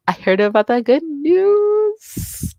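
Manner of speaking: high, slow sing-song tease. Shown as a demonstration. Streamer sing-songs the good news hint to her friend. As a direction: lift it up high and sing it slowly.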